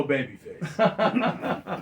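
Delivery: amused through laughter; chuckling while talking; laughing. Real, not acted. Delighted by the finish.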